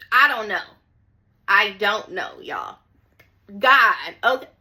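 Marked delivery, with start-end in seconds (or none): none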